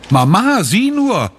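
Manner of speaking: put-on high, bright child's call to mother; little girl calling her mother, pitched high. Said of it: "ruft sie".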